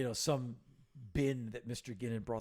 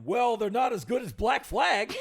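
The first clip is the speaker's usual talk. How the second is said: put-on loud old man; 9 semitones higher, 10 dB louder than usual. Mister Ginn voiced, laughing.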